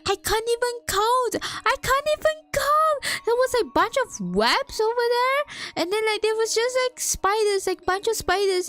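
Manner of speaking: freaking out. Real, not acted. Telling viewers about the spiders at her door, yelling in disbelief. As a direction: shout it like you can't believe it.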